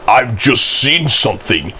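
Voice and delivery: usual speaking voice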